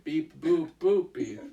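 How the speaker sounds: corrected beeps; toy robot voice. Shown as a demonstration. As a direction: beep boop boop beep like a toy robot. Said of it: "There we go".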